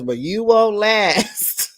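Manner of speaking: high, drawn-out retort; mock put-down. Vlogger quoting a mentor to her pupil, mock put-down. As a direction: stretch it out as a teasing clap-back. Acts out Brynn's clap back.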